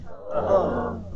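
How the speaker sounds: an "Oh" of disgust. Shown as a demonstration. Group exercise saying O to mean disgust, disgusted. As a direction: recoil in disgust on "Oh". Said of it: "to mean disgust".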